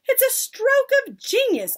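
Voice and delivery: very high, loud acclaim; cried out. A narrator's reading. Witches praising their leader, cried out. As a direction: gush it at the top of the voice. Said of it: "they cried".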